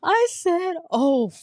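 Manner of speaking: high, slow words through laughter; real helpless laughter pushing the pitch up; cracking up. Real, not acted. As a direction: tell it while losing it laughing. Cracking up at her own story.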